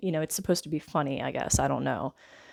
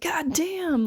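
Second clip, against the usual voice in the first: high-pitched retold outburst of dismay; mock exasperated exclamation; 11 semitones higher than usual. Gang boss nicked while shaving on a boat, mock dismay.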